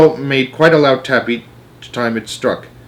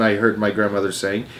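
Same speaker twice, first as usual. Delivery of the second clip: usual speaking voice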